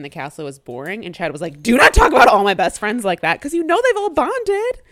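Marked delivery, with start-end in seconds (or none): 1.6-4.8 firm defense. Retold or imitated. Chad defending friends.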